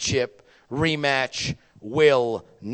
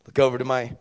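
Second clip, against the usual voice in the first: ordinary delivery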